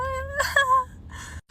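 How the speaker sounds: high, almost crying laugh; high-pitched laugh. Retold or imitated. Reacting to the date's summer bod jab, high disbelieving laugh.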